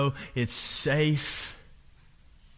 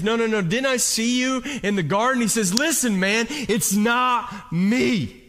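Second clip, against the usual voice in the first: heated accusation; 7 semitones higher, 4 dB louder than usual. Retold or imitated. Servant accuses, Peter denies.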